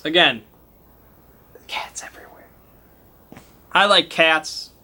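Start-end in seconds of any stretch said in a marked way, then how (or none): 1.7-2.5 soft unvoiced whisper; whispered aside. A narrator's reading. GM whispering about the cats to players, hushed. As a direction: hush it like a secret.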